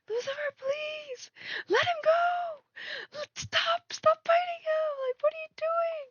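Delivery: very high, drawn-out mock pleading; squealing girl. Owner begging Lucifer to let the lizard go, squealing. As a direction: imitate yourself squealing helplessly at the pet. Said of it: "one of those girls who was just like".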